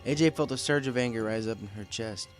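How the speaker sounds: ordinary delivery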